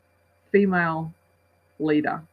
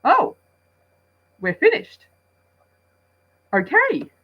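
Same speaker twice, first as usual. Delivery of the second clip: surprised; 11 semitones higher, 6 dB louder than usual. Retold or imitated. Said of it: "you was kinda like".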